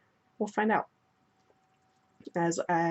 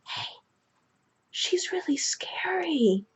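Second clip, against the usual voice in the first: put-on high, slow, whispery small child; stage-whisper like a scared little kid; 11 semitones higher, slower than usual. Little girl tugging her brother's shirt, high hushed whisper. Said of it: "And she goes".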